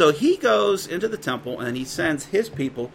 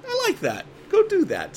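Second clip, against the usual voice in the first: much higher, lightly playful voice of God approving. Acted. God answers the angel's idea, in a bright, much higher approving voice. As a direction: pitch it way up as God saying yes.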